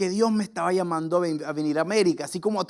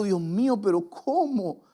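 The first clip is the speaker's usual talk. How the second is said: retold crying; 6 semitones higher, 5 dB quieter than usual. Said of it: "me empecé a llorar".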